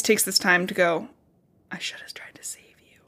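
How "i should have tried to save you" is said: very soft, whispered confession; acted whisper. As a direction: whisper it as a hushed regret. Acting out Katniss's hushed regret.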